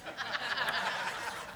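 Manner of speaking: a short real laugh, breaking out of the southern accent. Real, not acted.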